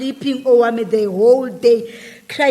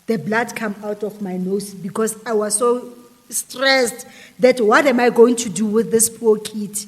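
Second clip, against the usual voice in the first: ordinary talk, nothing marked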